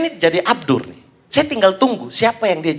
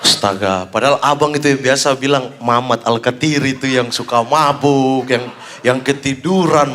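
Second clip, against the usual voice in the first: penggemar terheran; 7 semitones lower than usual. Acted. Shocked fan questions fake Ari. (terheran-heran, pelan dan rendah)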